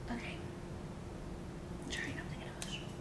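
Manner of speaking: quick choked whisper, near tears; fighting back tears. Real, not acted. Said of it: "I was getting a little emotional".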